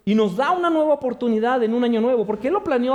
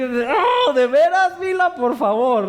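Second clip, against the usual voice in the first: high, louder, disbelieving plea; exasperated; 4 semitones higher, 3 dB louder, slower than usual. Retold or imitated. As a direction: throw up your hands at the baby. Exasperated father to baby.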